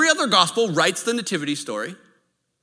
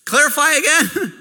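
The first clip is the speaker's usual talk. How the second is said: high, laughing, incredulous "what?"; a husband laughing in shock at the operator; shocked disbelief; 8 semitones higher than usual. Retold or imitated. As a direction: react like you misheard something alarming. Told to check for the head.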